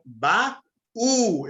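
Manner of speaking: "baú" broken slowly into two syllables; split hiatus. Shown as a demonstration. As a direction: pull "baú" apart, ba then a long stressed ú. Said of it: "é um hiato". ("baú" separado em hiato, ba-ú)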